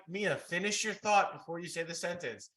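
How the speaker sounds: loud, high-pitched mock parental scolding; exasperated parent. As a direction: imitate a parent correcting a rambling child. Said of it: "and then I'm like".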